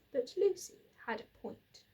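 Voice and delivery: plain reading voice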